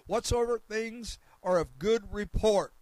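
loud, slow, emphatic scripture proclamation; ringing sermon delivery, phrase by phrase; preach. Real, not acted. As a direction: proclaim each phrase at full force.

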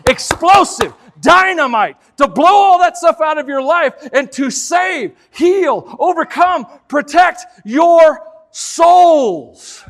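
loud, rising sermon climax; preaching at full force. Acted. Preacher driving home his point to the congregation, loud. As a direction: build it up like a revival preacher.

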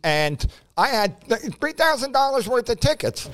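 usual speaking voice

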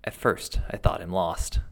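plain reading voice

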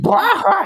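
high bleating mock laugh; goat-scream laugh. Imitating the guy laughing like a screaming goat, high bleat. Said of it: "He was laughing like the goat screaming".

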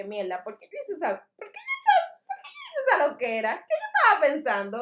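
high, laughing self-horror; cringing disbelief; mock cringe. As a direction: relive the embarrassment. 3am regret voiced.

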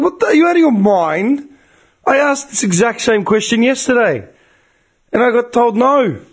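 high-pitched, incredulous indignation; retold customer rant, voice pushed right up; livid outburst. Customer told no yesterday confronts call centre, livid.

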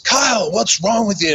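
high-pitched mock coach voice; put-on high voice for a protesting coach; imitating upset coach. Coach confronts him in hallway.

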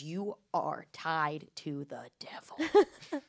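slow ominous whisper; spooky whisper. Retold or imitated. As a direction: breathe it like a curse. Psychic's dire warning.

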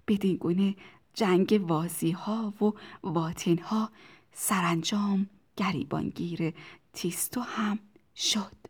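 ordinary delivery, nothing marked

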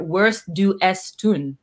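slow, separated words; deliberate, measured pronunciation attempt; careful foreign reading. Real, not acted. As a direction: sound out the German word by word. Reading out unfamiliar German phrase.

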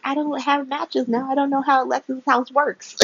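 high clueless mock voice; helpless Harmony. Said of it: "She's like".

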